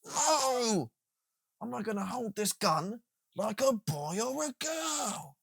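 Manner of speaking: high, slow, prissy mock refusal; mock defiant refusal. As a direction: play the stubborn recruit, stretched out. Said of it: "And I'm like".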